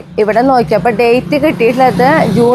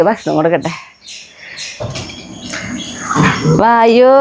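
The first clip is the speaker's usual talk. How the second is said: higher, sing-song calling; coaxing pet talk; 3 semitones higher than usual. Acted. Owner calling puppy Messi to food, sing-song. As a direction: call the pups sweetly to eat.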